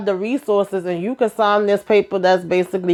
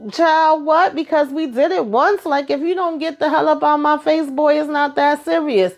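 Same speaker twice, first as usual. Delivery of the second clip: higher, dismissive voice of Effie; imitating Effie; 7 semitones higher than usual. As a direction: brush him off, higher and sassy. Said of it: "even damn Effie was like".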